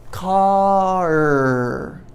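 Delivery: "car." said clearly as a model; demo word. Shown as a demonstration. Teacher modelling a word to the class. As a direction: hold out "car." for students. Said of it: "Do I use my voice with car?".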